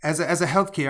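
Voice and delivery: real stumbling false starts; worked up and tripping over the words; riled-up stutter. Real, not acted. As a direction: keep restarting as the words pile up. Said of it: "I know I'm stuttering on this".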